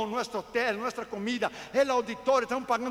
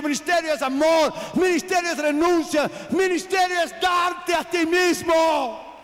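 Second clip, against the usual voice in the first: louder and louder shouted litany, each line hammered; preaching at full force; 5 semitones higher, 7 dB louder than usual. Acted. Preacher to congregation on ministry, rising shout. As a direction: preach it, climbing to a roar.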